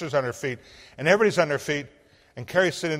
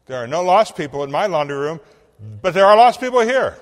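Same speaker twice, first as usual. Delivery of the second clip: building to a high loud burst; rising fervor, ending much higher and louder; wife insisting to the pastor about the rabbi; insistent; 5 semitones higher, 11 dB louder than usual. Retold or imitated.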